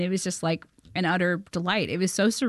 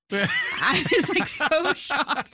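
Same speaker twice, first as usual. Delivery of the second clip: higher, slowed, laughing so shocked; laughing amazement; 8 semitones higher, much slower than usual. Real, not acted. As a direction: stretch it out through laughter. Recalling her amazement, laughing.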